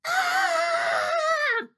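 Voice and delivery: piercingly high, long, loud shriek; sustained child's scream; all-out scream. A narrator's reading. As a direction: scream at full force for an audition. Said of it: "so I tried again".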